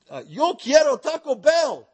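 a silly, put-on Spanish accent; mock Spanish accent. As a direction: worker calling down the tower in a mock Spanish accent. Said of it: "they holler down and say".

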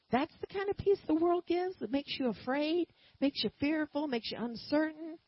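ordinary talk, nothing marked

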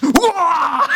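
high loud wah of surprise; laughing shout; yelp. Real, not acted. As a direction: yelp at the click. Reacting to the hang-up.

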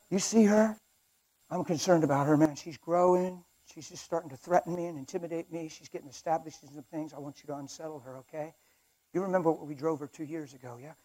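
hushed crime-boss briefing; very soft, conspiratorial devil; Satan as boss. Acted. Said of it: "I have Satan over here like this".

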